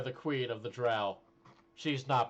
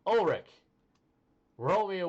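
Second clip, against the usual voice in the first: calling out; 7 semitones higher, 6 dB louder than usual. Real, not acted. GM calling out a player's name.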